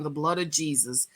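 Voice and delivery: ordinary talk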